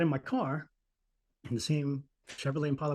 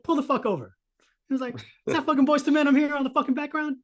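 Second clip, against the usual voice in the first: higher, louder angry yelling; mentor yelling; 11 semitones higher, 7 dB louder than usual. Retold or imitated. Mentor on the phone yelling at crying Marcus, loud and high. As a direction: bark it down the phone at him.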